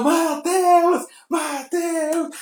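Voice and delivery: high, drawn-out name call; crowd calling out. Retold or imitated. The crowd calls to them as they say goodbye, calling out. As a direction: imitate fans calling from a distance.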